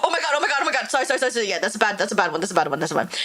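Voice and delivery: normal talking voice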